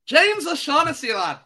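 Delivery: high, loud mock Irish lilt; hammed-up Irish, breaking into laughter; terrible Irish accent. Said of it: "That is a terrible Irish accent".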